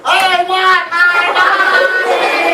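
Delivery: very slow, loud, high sing-song wail. Acted. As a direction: stretch it into a long loud howl. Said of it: "even louder".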